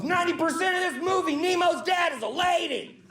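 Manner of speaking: high indignant shout. Retold or imitated. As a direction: yell the fact like a pedant who can't hold it in.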